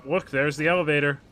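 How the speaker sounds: normal talking voice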